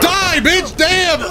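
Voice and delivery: shouting at the game. Real, not acted. Shooting enemy in game.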